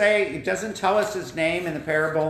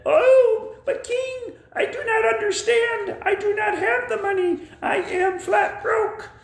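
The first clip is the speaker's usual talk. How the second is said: much higher pleading puppet voice; pleading debtor. Acted. Debtor pleads to the king, high squeaky puppet voice.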